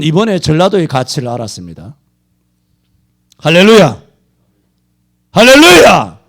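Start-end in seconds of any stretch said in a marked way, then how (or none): 3.4-6.2 high, loud, shouting sermon; fiery preaching. Real, not acted. Preacher scolding megachurches at full force, shouting. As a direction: thunder at the flock, slow and hammering.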